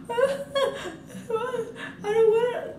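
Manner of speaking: imitating her own bawling, very high and very slow, laughing through it; mock bawling. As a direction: laugh while faking a drawn-out wail. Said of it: "I was bawling at this point".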